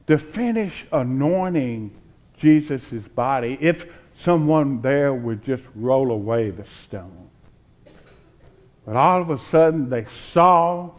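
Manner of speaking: ordinary talk, nothing marked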